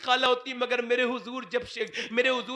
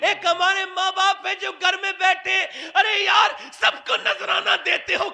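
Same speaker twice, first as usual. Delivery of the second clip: fiery preaching; 6 semitones higher, 6 dB louder than usual. Acted. Preaching at full force.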